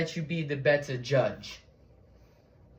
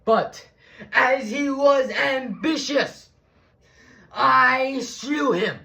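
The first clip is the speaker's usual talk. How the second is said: much higher, louder oratorical outburst; forceful declamation; 4 dB louder, slower than usual. Acted. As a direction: thunder the confession to the crowd. Acted justification speech reaching its climax.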